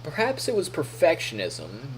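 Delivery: ordinary talk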